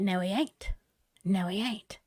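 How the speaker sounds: quiet, firm refusal under the breath; low determined mutter; muttered defiance. Retold or imitated. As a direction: dig in your heels quietly. Defiant inner reply.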